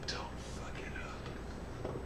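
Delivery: sensual whisper into the ear; whisper in left ear. Pretend. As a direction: breathe it softly into their ear. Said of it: "I whisper into your ear, sensually".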